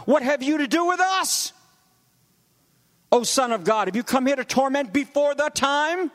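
lightly voiced demons crying out. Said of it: "the demons cried out".

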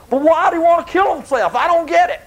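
put-on high-pitched whiny kid protest; puzzled seventh graders. Teacher mimics students baffled by Shakespeare, high mock-confused voice. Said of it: "They're like".

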